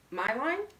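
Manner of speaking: crisply. A narrator's reading. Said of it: "said the stranger crisply".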